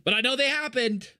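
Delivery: high, loud frustrated cry; frustrated yell. Real, not acted. Frustrated outburst at game.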